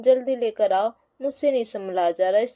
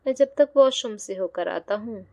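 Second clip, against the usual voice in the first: ordinary delivery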